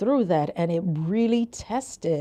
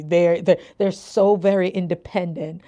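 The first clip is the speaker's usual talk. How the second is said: ordinary talk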